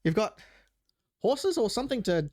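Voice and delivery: a hint of a spoiled aristocrat; prissy noble.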